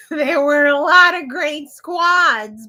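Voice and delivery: loud, slow announcer proclamation; cheer announcer voice. Retold or imitated. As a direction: boom it out like a competition host. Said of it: "That reference was from Bring It On".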